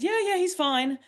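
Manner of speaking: high-pitched imitation of a reassuring caller; nice man reassuring. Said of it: "luckily this nice Irish man".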